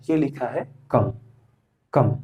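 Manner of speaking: कम said as kom, B left silent; correct silent B. Shown as a demonstration. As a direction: end कम on the M sound. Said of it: "इसका correct pronunciation". (कम, बी silent वाला सही उच्चारण)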